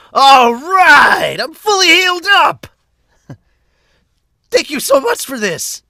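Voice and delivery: very high, loud cheering hero; squealing happy shonen hero; overjoyed hero. Acted. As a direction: shout it out, thrilled to be healed. Hero cheers after being healed.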